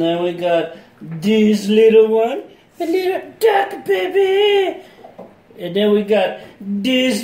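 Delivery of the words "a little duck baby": excited squeal. Real, not acted. Excited toy reveal.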